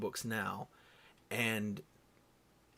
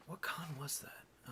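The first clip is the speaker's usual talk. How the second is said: whispered aside to himself; whispering; 4 semitones higher, 12 dB quieter, slower than usual. Real, not acted. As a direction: breathe the question, barely voiced.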